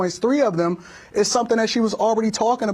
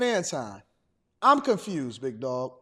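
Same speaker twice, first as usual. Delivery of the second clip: low voiced texter; 9 semitones lower, 7 dB quieter, slower than usual. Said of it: "They said".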